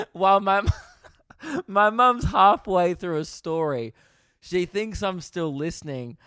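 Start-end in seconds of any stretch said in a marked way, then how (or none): 0.0-3.9 real laughing. Real, not acted. Son telling how mum overheard him, laughing through it. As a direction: crack up while trying to tell it. Cracking up at own story.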